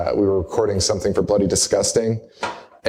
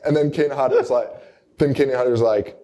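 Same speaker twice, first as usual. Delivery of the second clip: ordinary delivery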